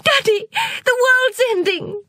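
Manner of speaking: high, panicked cry to father; frightened cry. Acted. Girl crying to her father, high and panicked. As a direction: wail it to daddy. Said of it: "cried Amrita".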